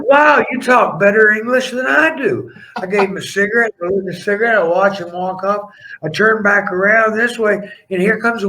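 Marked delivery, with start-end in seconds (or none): 0.1-2.5 amazed. Retold or imitated. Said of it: "I'm like".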